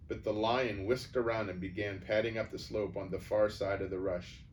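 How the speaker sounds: ordinary delivery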